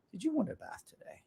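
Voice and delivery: high, soft cooing to the dog; pet voice. Real, not acted. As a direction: softly coo the question to the dog, high. Asking dog about bath.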